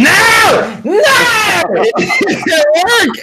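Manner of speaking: high anguished cry, half laughing; frustrated wail. Real, not acted. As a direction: wail it out in despair. Frustrated at broken audio, yelling.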